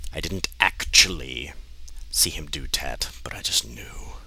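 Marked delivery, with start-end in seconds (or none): none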